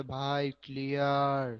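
drowsy, slow mock student mumble; sleepy student. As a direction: lightly play a half-asleep student. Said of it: "ঘুমায়, ঘুমায় বলে". (ঘুমন্ত ছাত্রের নকল)

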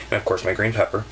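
usual speaking voice

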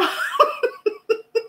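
a very high, soft giggling burst; helpless high laughter. Real, not acted. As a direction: lose it at the joke. Cracks up after rábano joke.

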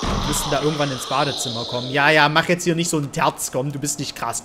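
ordinary talk, nothing marked

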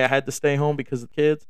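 usual speaking voice

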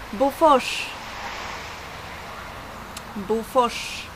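Bofors with rs said as "sh"; Swedish Bofors. Shown as a demonstration. Teacher showing viewers the name Bofors, Swedish pronunciation. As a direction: model Bofors in Swedish, word on its own. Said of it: "words that I should, uh, pronounce".

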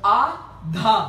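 आ, a short gap, then धा landed on the beat. Shown as a demonstration. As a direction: count in to land on धा. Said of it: "धा पे उसका सम आ जाता है". (धा पर सम दिखाते हुए)

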